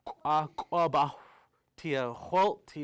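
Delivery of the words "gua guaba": plain reading voice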